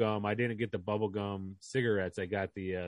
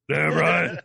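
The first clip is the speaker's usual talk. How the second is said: loud, higher promo shout; wrestler voice; 5 semitones higher, 10 dB louder than usual. Pretend. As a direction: bark it like a wrestler. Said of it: "I don't have a wrestler voice".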